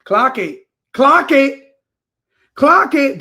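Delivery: building from firm to loud; emphatic call-out. Real, not acted.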